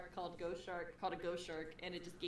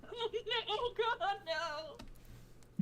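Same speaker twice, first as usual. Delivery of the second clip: slow, drawn-out higher mock wail; mock terror; 10 semitones higher, much slower than usual. Imagining a skeleton in the ship window, mock terrified wail. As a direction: stretch it out like you're haunted. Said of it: "and I'm gonna be like".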